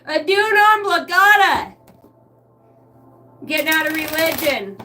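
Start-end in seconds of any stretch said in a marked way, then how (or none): 0.1-1.7 loud, high mock oracle voice; a hammed-up mystical chant; mystic pronouncement. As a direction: intone it like a spooky reading. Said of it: "it told me".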